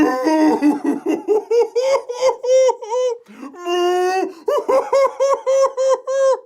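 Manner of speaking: high, loud mooing laugh; shrieking hammed-up cow laughter. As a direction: guffaw like a cow. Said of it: "laughing like only a cow can laugh".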